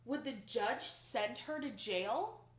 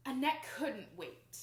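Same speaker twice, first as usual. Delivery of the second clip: ordinary delivery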